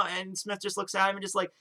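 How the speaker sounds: ordinary delivery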